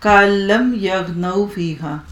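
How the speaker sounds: slow, measured Arabic recitation; Quranic recitation. Shown as a demonstration. Teacher recites the verse slowly to her students. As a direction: draw out each word as in tilawat.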